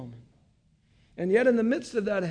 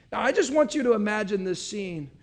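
ordinary talk

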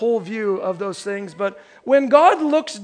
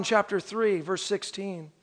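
usual speaking voice

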